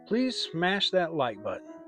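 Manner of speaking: ordinary delivery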